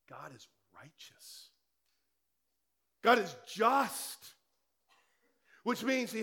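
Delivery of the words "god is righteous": breathy hushed whisper, slowed; whispered awe. Real, not acted. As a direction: drop to a whisper for weight. Hushed preaching climax.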